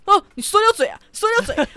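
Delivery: high, loud taunting mom voice; mocking mom. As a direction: gloat loudly like catching a slip. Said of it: "然后我妈就". (高声大声嘲笑说溜嘴)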